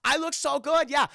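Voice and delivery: high, loud mock Chinese accent; cartoonish put-on Chinese accent; exaggerated Chinese-accent character squeal; caricatured Chinese accent. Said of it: "Chinese caricature voiced by Rob Schneider".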